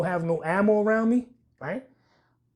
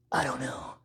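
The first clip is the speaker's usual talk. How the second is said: normal talking voice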